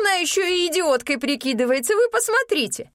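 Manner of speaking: high, loud indignant cry; indignant outcry. Acted. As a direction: rouse the crowd, outraged. Innkeeper mocks to the crowd.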